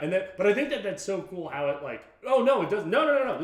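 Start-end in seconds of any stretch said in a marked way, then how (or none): 2.2-3.4 high, loud string of denials; emphatic mock protest. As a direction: protest it, pitched up and louder. Voices an objection within lively film talk.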